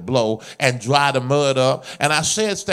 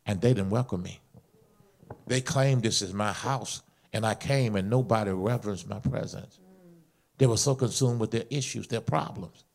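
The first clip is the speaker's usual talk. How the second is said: low, soft confiding delivery; hushed, intimate sermon storytelling; subdued testimony; 6 semitones lower, 7 dB quieter than usual. Real, not acted. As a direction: bring it right down and tell it quietly. Quietly retells God's reply.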